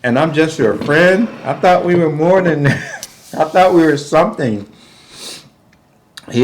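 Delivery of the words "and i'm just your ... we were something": high, mock-wounded pleading with a laugh creeping in; imitated near-crying. Man protesting he's just a friend, mock tearful. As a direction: mimic a hurt boyfriend close to tears. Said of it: "He's actually kinda crying, like".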